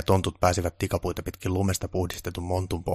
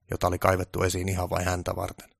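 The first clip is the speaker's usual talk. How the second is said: usual speaking voice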